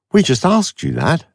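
high, needling complaint; irritable, irritating. A narrator's reading. Villa needles Avon for dodging, high and irritated. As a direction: snipe at him like a pest. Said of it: "Villa said irritably and irritatingly".

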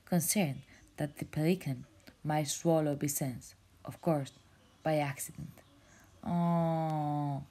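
ordinary delivery

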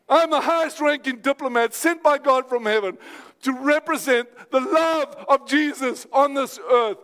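high, ringing declarations of faith; full-force preaching. Real, not acted. Preacher urging congregation to preach to themselves, high impassioned exhortation.

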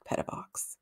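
breathy whispered name; sighed out in a whisper. A narrator's reading. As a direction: drop to a bare whisper. Brumby sighs the name.

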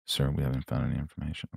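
very low, soft intense actor voice. Retold or imitated. Guest star murmuring his line, very low and quiet. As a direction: barely voice it, deep and hushed. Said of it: "I was like".

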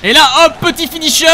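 gleeful. Real, not acted. Finishing move in game.

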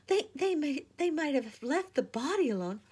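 high and loud, distressed; stumbling, outraged grief; upset cry. A narrator's reading. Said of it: "said Lucy".

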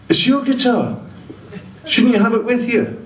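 high scolding passenger. Retold or imitated. Passenger chiding the comedian, in a high prim voice. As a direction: tut at him in a high prim voice.

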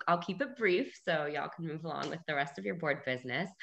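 ordinary talk

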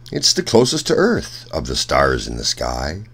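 ordinary delivery

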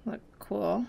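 ordinary talk

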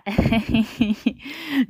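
short embarrassed laugh; awkward laugh. Real, not acted. Laughing at her own face joke, embarrassed chuckle. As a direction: laugh it off, a little shy.

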